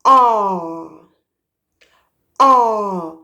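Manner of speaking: wide open "ah", tongue back. Shown as a demonstration. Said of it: "Another sound of the letter A is".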